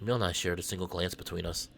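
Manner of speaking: low, soft narrator read; story narration. Acted. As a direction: read the scene to the viewers, calmly. Reads game narration.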